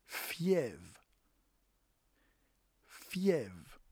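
"Fièvre." said with a falling tone and no final r; Creole word demo. Shown as a demonstration. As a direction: model the Creole "Fièvre." for learners. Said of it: "in Creole is fièvre".

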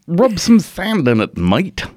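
mock Australian, trying harder; Australian accent. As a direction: have another stab at sounding Australian. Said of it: "My Australian accent's fucking terrible".

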